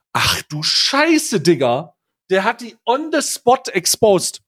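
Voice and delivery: high, slow disbelieving shriek; shocked. Real, not acted. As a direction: squeal it out in disbelief. Shocked reaction to Krämer's reveal.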